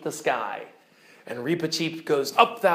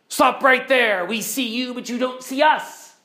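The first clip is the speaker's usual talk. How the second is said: loud, high commanding call; acted shout from unseen creatures; threatening challenge; 9 semitones higher, 5 dB louder than usual. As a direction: halt them with a raised voice. Hidden voices challenge the visitors.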